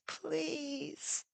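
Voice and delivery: breathless whispered please; a broken, whispered begging. Acted. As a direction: barely voice the word. Pollux begging Zeus.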